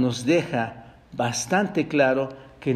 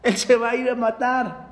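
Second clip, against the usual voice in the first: mocking guffaw; 6 semitones higher than usual. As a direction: jeer with a put-on belly laugh. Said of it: "reírse, carcajearse, hermanos, y decir".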